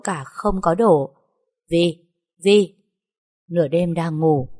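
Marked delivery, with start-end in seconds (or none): 1.7-2.7 louder call of a name in the night. A narrator's reading. A ghostly voice calls her name at midnight, calling out.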